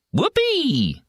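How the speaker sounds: high whooping yell; excited yell. A narrator's reading. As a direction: whoop with glee. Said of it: "yells Snuffy".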